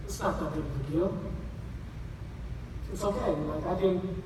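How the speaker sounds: soft, low, gentle reassurance; reassuring son. Acted. Son comforting his father after the trip is cancelled, soft and gentle. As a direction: ease your dad's guilt softly.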